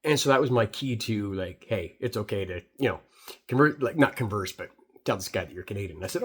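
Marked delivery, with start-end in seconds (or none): none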